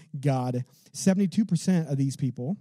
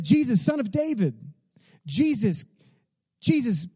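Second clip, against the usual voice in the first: crying out; 8 semitones higher than usual. Retold or imitated. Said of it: "he starts crying out to Jesus".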